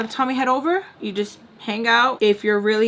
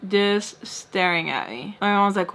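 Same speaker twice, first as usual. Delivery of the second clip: ordinary talk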